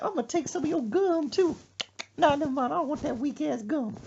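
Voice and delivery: high-pitched bossy mother; mama voice. Retold or imitated. Mother taking then refusing gum, high mock voice. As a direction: put on a high, dismissive mama. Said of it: "She said".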